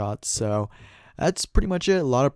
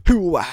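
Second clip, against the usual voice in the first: falling scream; 7 semitones higher, 8 dB louder than usual. Real, not acted. Outro battle cry.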